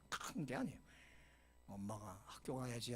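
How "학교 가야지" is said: put-on soft, tender morning call to a child; tender mother. Shown as a demonstration. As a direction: gently coax a sleepy daughter up. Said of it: "하고 다정하게".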